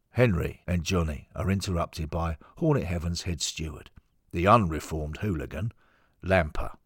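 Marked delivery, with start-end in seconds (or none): none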